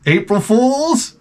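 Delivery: lightly hoping it's a joke; hopeful question. Retold or imitated. Dad hoping the fat remark was a joke. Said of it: "I was like".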